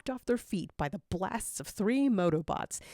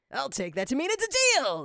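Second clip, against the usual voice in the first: high, loud gleeful cry; villain grabs his prize, delighted and loud; delighted exclaim; 8 semitones higher, 5 dB louder than usual. A narrator's reading. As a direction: crow over the deal. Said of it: "Eggman exclaims with delight".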